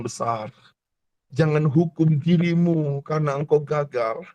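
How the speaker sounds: hushed altar-call preaching. Acted. Soft, intimate altar-call preaching.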